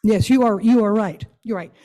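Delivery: ordinary talk, nothing marked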